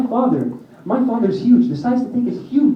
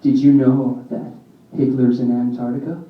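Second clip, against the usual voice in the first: intense revelation; 8 semitones lower than usual. Retold or imitated. Cousin tells him a conspiracy theory at the table, low and intense. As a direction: share a secret theory with grave intensity.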